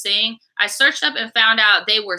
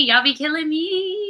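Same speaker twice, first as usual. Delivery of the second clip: laughing; 9 semitones higher than usual. Real, not acted. Collapses laughing.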